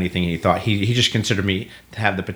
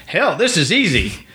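mock cocky; 10 semitones higher than usual. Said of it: "I was like".

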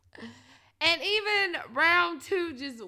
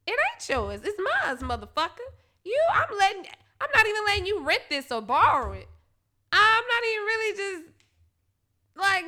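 indignant rant; 7 semitones higher, 7 dB louder than usual. Retold or imitated. Ranting at partner's question.

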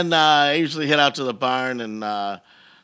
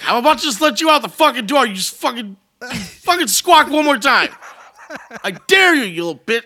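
threatening the birds; 8 semitones higher, 5 dB louder than usual. Retold or imitated. Yelling at the lovebirds.

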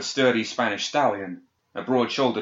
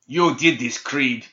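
angry, loud accusation; accusing shout; 4 semitones higher, 5 dB louder, slower than usual. A narrator's reading. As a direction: hurl the blame at her. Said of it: "he shouted to her in accusation".

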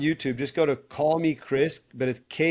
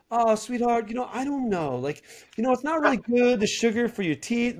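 higher, syrupy imitation of a gentle modern mom; sweet modern mom; 8 semitones higher than usual. Imitating a modern mom sweetly coaxing her kid about candy, higher pitched. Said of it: "And she's like".

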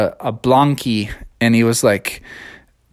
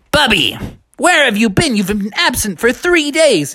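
high, stern teacher voice; teacher to pupil, high, mock stern; scolding Doctor Possum; 10 semitones higher than usual.

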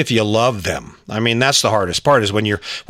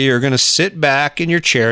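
ordinary delivery

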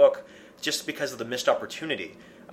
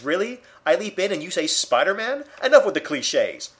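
mock-exasperated, higher and louder; sarcastic quip; 5 semitones higher, 5 dB louder than usual. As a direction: scoff at the villain's cliché. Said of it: "that sarcasm he uses".

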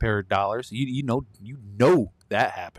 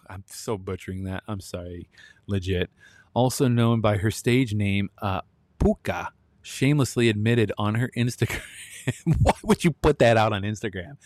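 usual speaking voice

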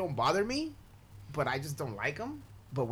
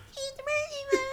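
very high squeal of mockery; mocking cousins. Cousins tease the kid about the clown, mocking squeal. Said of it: "And my cousins are like".

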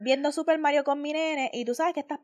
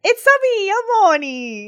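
high, slow Mario catchphrase; hammed-up Italian plumber greeting; 8 semitones higher, 7 dB louder, slower than usual. Host opening the show in a mock Mario voice. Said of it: "Mario se presentaba y decía".

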